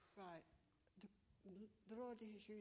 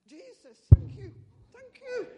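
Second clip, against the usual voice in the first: very loud, high rising praise shout; 30 dB louder, slower than usual. Retold or imitated. Standing in Tesco with arms raised, shouting very loud high praise. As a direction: belt it out with arms in the air.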